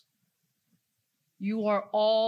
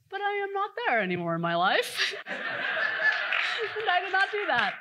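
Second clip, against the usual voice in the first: talking through laughter, much higher; laughing; 11 semitones higher, 4 dB louder than usual. Real, not acted. Speaker laughing at his own past with the audience. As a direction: laugh it off while admitting it.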